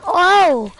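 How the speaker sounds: high, loud startled cry; surprised shout. Real, not acted. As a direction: blurt it out, startled. Sudden game event.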